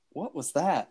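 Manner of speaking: mildly acted "what on earth?"; retold puzzlement. Retold startled reaction to noise.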